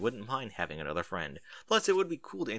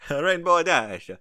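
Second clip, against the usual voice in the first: 1 laughing at a misread name; 5 semitones higher, 9 dB louder, slower than usual. Real, not acted.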